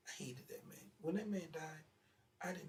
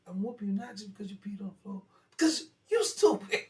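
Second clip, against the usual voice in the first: hammed-up angry scolding; scolding uncle; 6 semitones higher, 15 dB louder than usual. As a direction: yell the insult as the uncle. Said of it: "He said".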